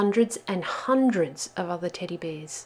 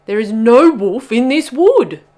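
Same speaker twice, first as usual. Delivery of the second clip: loud, higher, emphatic denial; exasperated snap; 6 semitones higher, 18 dB louder than usual. A narrator's reading. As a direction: insist crossly at full voice. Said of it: "snapped Bubba".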